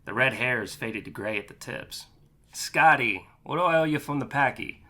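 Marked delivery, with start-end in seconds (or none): 2.5-4.8 lightly voiced Boston accent; a hint of a heavy Boston trucker accent. Boston trucker greets his friend, light Boston accent. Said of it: "Boston slang flowing through a heavy accent".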